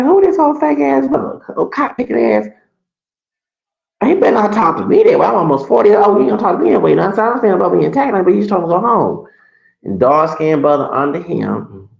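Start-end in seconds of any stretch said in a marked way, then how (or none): 0.0-2.5 high-pitched retold outrage; indignant retort. 4.0-9.3 indignant. Retold or imitated. Retold indignant reaction to being cursed.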